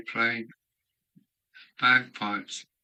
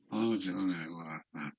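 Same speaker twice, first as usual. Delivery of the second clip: usual speaking voice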